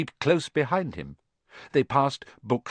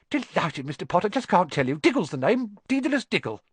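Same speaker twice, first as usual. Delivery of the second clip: higher, bubbly Diggle voice; excitable little man; 7 semitones higher than usual. Acted. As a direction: introduce yourself all aflutter. Diggle introducing himself excitedly.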